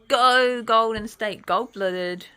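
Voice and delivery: usual speaking voice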